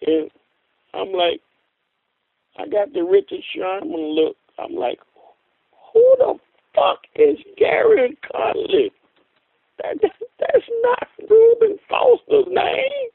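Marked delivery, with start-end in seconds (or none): 5.9-13.1 high, slow incredulous outburst; stunned disbelief. Retold or imitated. Fan reliving an unknown name being announced, stunned. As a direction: relive the shock with pitch soaring. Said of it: "I was just stunned".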